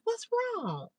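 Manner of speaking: high, soft worried question; concerned mother imitated. Mother asks tearful daughter at home, concerned.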